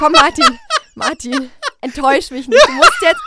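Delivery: high, loud begging through laughter; laughing plea. Real, not acted. Host begs guest to tell a joke, laughing plea. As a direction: coax him loudly while cracking up.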